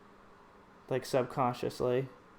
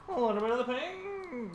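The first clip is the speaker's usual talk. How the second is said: pitched way up, singsong; high put-on voice; 8 semitones higher than usual. Artist muttering to herself in a high silly voice. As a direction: lift it high and playful. Drawing, repeats line in odd voice.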